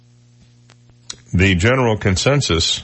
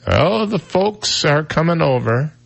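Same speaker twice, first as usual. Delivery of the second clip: put-on slow, higher voice of an old-timer; old person voice; 8 semitones higher, slower than usual. Host imitating old people saying folks, slow and higher. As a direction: mimic an old relative. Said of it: "really old people say".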